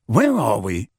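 ordinary delivery

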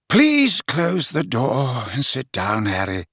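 put-on slow, weary old voice; rather tired. A narrator's reading. Dumbledore to Harry, tired and slow. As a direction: let the fatigue show, gently. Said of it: "sounding rather tired".

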